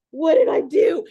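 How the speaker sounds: loud, high self-reproach; mock panic. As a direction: wail it like you just blew the call. Retold panic after phone blunder.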